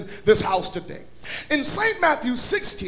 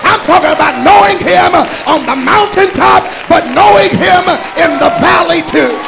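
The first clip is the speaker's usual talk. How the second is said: loud, sung-out preaching over the organ; full-force preaching; 2 semitones higher, 9 dB louder than usual. Acted. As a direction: preach it out with fire to the church.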